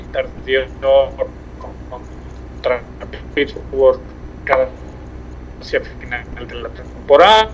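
choppy, breaking-up phone audio; crackly cordless phone. Real, not acted. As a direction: voice cutting in and out on a bad mobile line. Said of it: "se escucha un poco entrecortado".

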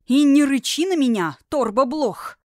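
loud high scolding; angry scolding. A narrator's reading. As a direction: scold him sharply and loud. Scolding the growling dragon.

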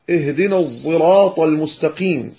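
الصراط said with a voiced, z-tinged Sad; Sad blended with Zay. Shown as a demonstration. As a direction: recite الصراط as "zirat", an emphatic Z-like Sad. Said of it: "بالصاد المشمومة زايا".

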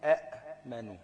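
"أأمنوا" with a clear stop after short a; a, glottal catch, manu in "أأمنوا"; original two hamzas. Shown as a demonstration. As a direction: split "أأمنوا" on the unvowelled hamza. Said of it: "فإن أصل آمنوا".

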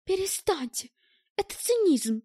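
high-pitched, restrained indignation; indignant. A narrator's reading. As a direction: protest as if hurt by the cynicism. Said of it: "возмутилась круглоглазая".

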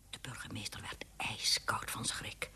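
ordinary delivery

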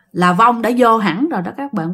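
ordinary delivery, nothing marked

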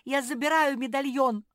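high, loud summons; shouting. A narrator's reading. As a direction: call out boldly to someone who must hear. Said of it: "закричала Мафи".

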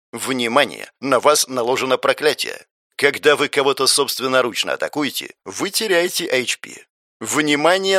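plain reading voice

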